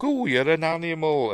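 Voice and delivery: high, put-on voice of the film lover; mock aroused lover. Woman to lover while making out, mock excited.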